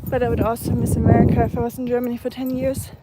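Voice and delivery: breathless from climbing a hill; out of breath. Real, not acted. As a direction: talk out of breath while walking uphill. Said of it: "I'm so out of breath".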